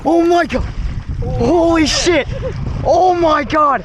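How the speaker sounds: high, loud, ecstatic yells; excited shouting. Real, not acted. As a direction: scream with pure joy. Huge fish landed, screaming.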